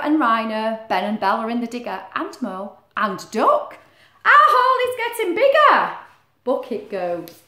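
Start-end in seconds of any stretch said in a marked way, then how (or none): 4.2-6.0 higher and louder with glee; thrilled to see the hole grow; excited character voice. Acted. Animals proudly watching their hole, higher and louder.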